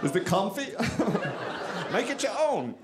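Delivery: put-on high, whimsical German-accented guard; terrible German prison guard. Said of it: "make a terrible German prison guard".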